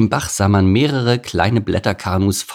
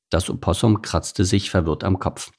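plain reading voice

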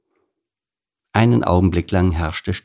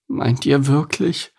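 tentative question, pitched up; wavering cow, half convinced; doubtful; 7 semitones higher than usual. A narrator's reading. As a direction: ask doubtfully, higher-voiced. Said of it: "fragte Kuni zweifelnd".

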